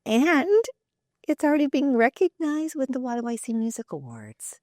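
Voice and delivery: excited praise. Real, not acted. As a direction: gush about the award nomination. Interviewer enthused about award.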